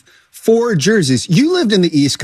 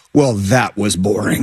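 low, slow, underwhelmed verdict; unimpressed; 6 semitones lower than usual. Retold or imitated. Fan summing up a dull Super Bowl, unimpressed.

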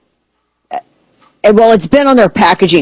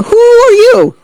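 very high, drawn-out mock challenge; mock indignation; slower than usual. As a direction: squeal it in pretend disbelief. Mock challenge to block cheese buyers.

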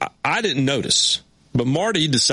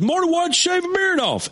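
puzzled fan. Retold or imitated. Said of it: "some guy goes".

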